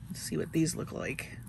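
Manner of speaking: drops to an unvoiced whisper while peering close; hushed murmur under the breath. Real, not acted. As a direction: breathe the words as you lean in to look.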